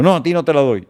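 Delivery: acted-out emphatic no in Spanish; sharp refusal. Acting out Che's refusal.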